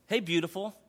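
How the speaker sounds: soft loving hello; tender greeting. Retold or imitated. Pastor voicing God gently greeting a messy child.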